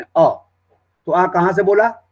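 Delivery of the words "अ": a plain open "अ" from the throat; throat vowel a. Shown as a demonstration. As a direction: sound "अ" straight from the throat, mouth open. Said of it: "जैसे अ बोलना है आपने".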